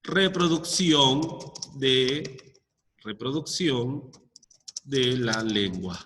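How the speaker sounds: slow, drawn out as if writing on the board; dictating to the class word by word; slow dictation. Shown as a demonstration. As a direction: spell it out slowly while writing. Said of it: "Lo pongo acá".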